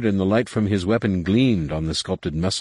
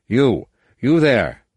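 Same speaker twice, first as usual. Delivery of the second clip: slow, loud, higher nervous call-out; an alien commander calling nervously across the smoke; 4 semitones higher, 5 dB louder, slower than usual. A narrator's reading. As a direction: call out, trying to hide the fear. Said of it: "Nomanor called nervously".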